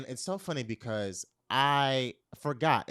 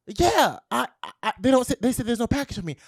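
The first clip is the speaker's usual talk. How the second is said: high, loud stammer rushing onward; flustered caller; 9 semitones higher, 9 dB louder, faster than usual. Retold or imitated. Date on the phone about his missing package, flustered. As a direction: imitate him agitated, tripping into fast speech. Caller's agitated reply, retold.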